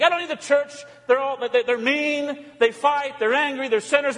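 high-pitched, indignant churchgoer's grumble; mock complaint. Loner on the edge griping about the church, indignant.

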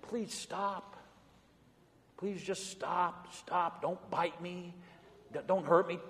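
mock frightened plea. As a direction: beg the barking dog to leave you alone, timid and hushed. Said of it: "What did I do?".

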